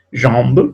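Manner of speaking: jambe with nasal an, silent final e; French jambe. Shown as a demonstration. Teacher to class, modelling French jambe. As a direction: say jambe as ʒɑ̃b.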